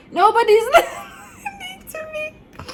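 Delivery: high, slow, sobbing through laughter; crying while laughing. Retold or imitated. Retelling her plea to the nurses, laugh-sobbing. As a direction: wail it, giggling at yourself. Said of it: "I started crying".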